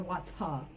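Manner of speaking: "wata" with a clear T and no final R; British-style "wata", R dropped; UK pronunciation. Shown as a demonstration. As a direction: end "wata" on an open "ah" after a true T. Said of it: "they have the T, or wata".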